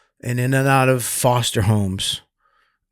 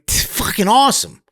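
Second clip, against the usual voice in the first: proud retold boast; 10 semitones higher than usual. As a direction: crow about taking the beating. Retold bragging.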